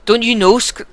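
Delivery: high Scottish lilt, cut off; accidental Scottish accent. Acted. Player voicing Vognir to Scrivener, slips into Scottish accent. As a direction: slip into Scottish by mistake. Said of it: "I've gone Scottish".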